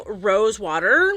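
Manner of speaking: ordinary talk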